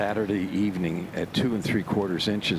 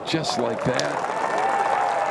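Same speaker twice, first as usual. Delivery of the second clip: drawn out; 5 semitones higher, 3 dB louder, much slower than usual. Real, not acted. Commentator savoring a perfect shot.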